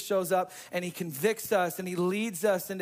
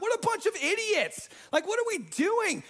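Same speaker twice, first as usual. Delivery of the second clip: exasperated self-quote; 10 semitones higher than usual. Retold or imitated. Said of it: "I'm like".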